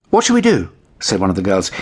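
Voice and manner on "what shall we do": put-on high worried girl voice; little girl. A narrator's reading. Girl asking her sister about the wet kitten. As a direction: ask like an anxious little girl.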